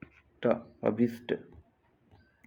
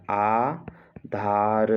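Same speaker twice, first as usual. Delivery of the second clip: "Aadhaar" very slow, held आ, pause, धार; split slowly for dictation; much slower than usual. Shown as a demonstration. Teacher dictating a spelling, Aadhaar slowly in two parts. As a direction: stretch both halves of "Aadhaar".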